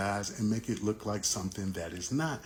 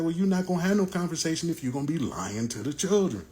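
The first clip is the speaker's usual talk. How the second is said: heated retort; 7 semitones higher, faster than usual. Retold or imitated. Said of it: "I'm like".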